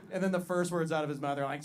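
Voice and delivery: ordinary talk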